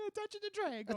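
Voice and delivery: high, lilting Irish brogue; Irish accent. Acted. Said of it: "You sound Irish there".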